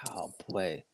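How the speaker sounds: a disbelieving whispered aside; hushed whisper. Real, not acted. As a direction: breathe it out under your breath, awed. Recalling striker out of breath.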